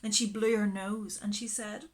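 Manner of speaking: ordinary delivery, nothing marked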